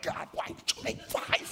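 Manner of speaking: slow, strained, voiceless outburst; comically exasperated, forced through without voice; mock angry agitation. Man at prayer complaining to God about flies, mock anger. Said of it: "getting more and more angry".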